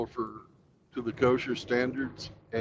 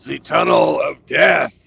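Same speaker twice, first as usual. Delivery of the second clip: ominous slow announcement; 2 semitones higher, 9 dB louder, slower than usual. Pretend. Dramatic name of slaughter tunnel.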